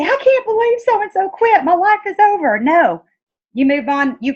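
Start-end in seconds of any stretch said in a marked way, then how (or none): 0.0-2.6 high, hammed-up wail of despair; mock despair. Mocking an upline whining to her downline about a quitter. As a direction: whine like your life just ended.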